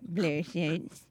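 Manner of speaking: mock-smoldering, slurred delivery; put-on character. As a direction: drag it out as a silly seductive thank-you. Playing a smitten parent thanking counselors.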